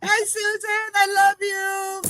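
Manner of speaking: very high, excited hello; high-pitched loving call; affectionate greeting. Real, not acted. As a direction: squeal hello to a dear friend. Greeting friend joining the live.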